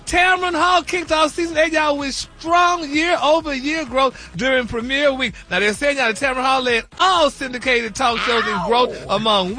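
celebrity news read. Acted. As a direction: sell the headlines like a showbiz news segment.

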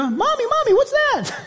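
put-on high, childlike squeal; baby zebra voice. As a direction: play the scared little zebra.

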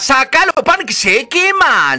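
high, loud, slow calling voice; oven's plea. Acted. Oven begs the lazy girl to take out the bread, high and loud. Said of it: "el horno que le decía".

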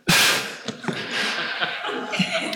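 a long breathy sigh with a half laugh; exasperated sigh. Real, not acted. Pastor sighing at crowds he now must love, exasperated sigh. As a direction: sigh it out, overwhelmed. Overwhelmed by new people arriving.

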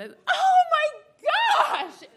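high, loud, drawn-out shriek; scream. Retold or imitated. [name] screaming in class as the dirty joke clicks. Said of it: "And I screamed".

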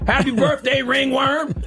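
high-pitched teasing birthday shout-out; mock birthday greeting. Mocking how people get reduced to one trait, high teasing greeting. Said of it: "We will reduce people to whatever".

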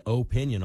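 "opinion" started with a full OH; OH-pinion, the first vowel held long. Shown as a demonstration. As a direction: say "opinion" as "oh-PIN-yun". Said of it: "the long O in there".

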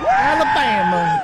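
Alabama drawled high and slow, mock Southern; mock Southern accent. Host mocking AOC, echoing her Alabama in mock Southern drawl. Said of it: "mocking AOC accent on Alabama".

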